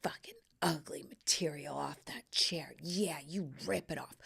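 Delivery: unvoiced, lustful whisper at a chair; breathy porn-watcher whisper. Watching reupholstery videos, she whispers to the worker. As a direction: egg on the upholsterer in a hushed whisper. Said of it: "I'm like".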